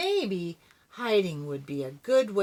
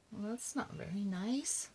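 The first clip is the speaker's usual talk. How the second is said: soft aside; 3 semitones higher, 11 dB quieter than usual. Real, not acted. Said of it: "reader's soft aside to listeners".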